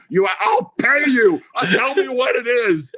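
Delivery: very high, strained-up imitation of begging; mock desperate pleading. Janofsky holding Dave by the lapels, acted desperation. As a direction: imitate someone frantic to buy the secret.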